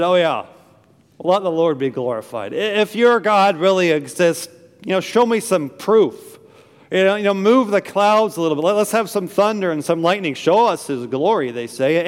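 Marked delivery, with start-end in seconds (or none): 0.0-2.5 mocking scoffer's "oh yeah"; mock praise, said in disbelief; sarcastic. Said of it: "it's sarcasm". 2.5-11.5 higher, louder taunting challenge; mocking skeptic demanding a show; sarcastic. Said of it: "it's sarcasm".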